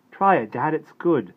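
put-on coaxing boy. A narrator's reading. Boy urging his father to try the stew, high coaxing voice. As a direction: urge Dad to taste.

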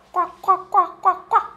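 bouncy puppet quacks; duck quack. Pretend. Teacher quacking as the toy duck jumps, high voice.

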